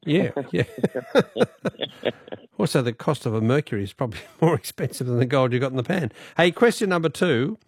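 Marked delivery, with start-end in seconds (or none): 0.7-2.3 long run of chuckles; amused laugh. Real, not acted. Host reacting to caller's dying remark, amused chuckling.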